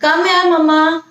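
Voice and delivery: a hint of a little child calling; lightly voiced kid asking mama to come; child calling mother, lightly done. Shown as a demonstration. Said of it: "बच्चा भी ... बुला सकता है".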